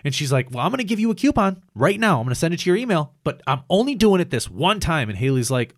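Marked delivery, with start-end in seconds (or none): 0.5-5.1 high, loud mock-annoyed voice; mad manager. Manager giving a coupon to Haley, imitated mad voice. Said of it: "and she was all mad".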